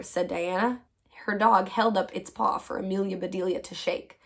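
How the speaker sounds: ordinary delivery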